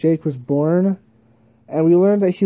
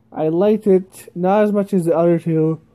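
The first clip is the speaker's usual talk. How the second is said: normal talking voice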